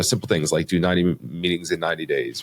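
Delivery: ordinary talk, nothing marked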